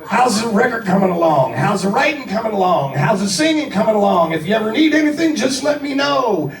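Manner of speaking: big high rock-star hype voice, speeding up then slowing down. Retold or imitated. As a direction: gush like an overexcited rock frontman. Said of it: "and he's like".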